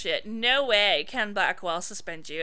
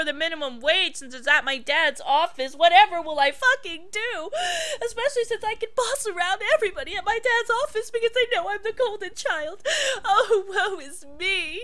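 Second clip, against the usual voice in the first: mock spoiled whining; 7 semitones higher, 8 dB louder than usual. Mocking Victoria's whiny text.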